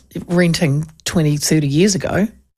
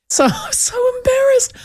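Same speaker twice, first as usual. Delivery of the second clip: laughing through the words, much higher; embarrassed laugh. Real, not acted. Host laughs about her messy backyard. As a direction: giggle it out, a bit mortified.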